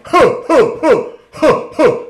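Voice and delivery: loud, high character grunts and shouts; overdone stage business. Retold or imitated. Friend overdoing his audition, loud hammy Big Julie. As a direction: pile on big punchy huhs. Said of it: "do all that business".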